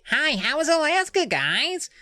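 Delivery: high nasal voice; Lauren. Acted. Visiting friend greets the kids about Alaska, high nasal voice. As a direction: exaggerate the squeaky, nasal friend. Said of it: "High nasal voice".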